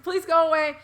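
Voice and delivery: high, loud pleading whimper; high, loud begging to the intruder; frightened plea. Retold or imitated. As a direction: beg him to leave, scared.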